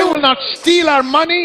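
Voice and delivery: high-pitched preaching shout; bold proclamation. Real, not acted. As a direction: declare it with full force to the crowd. Preacher leads bold public declaration.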